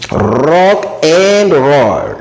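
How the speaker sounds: very slow, drawn-out "Rock and roll"; hammed-up, long-held "Rock and roll"; musical rock and roll. Shown as a demonstration. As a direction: stretch each word of "Rock and roll" like a music cry. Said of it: "c'est la musique, non?".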